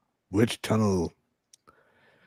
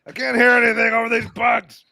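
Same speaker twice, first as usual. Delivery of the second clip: high shout over noise; 9 dB louder than usual. Acted. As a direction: shout to be heard over the buzz. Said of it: "be like".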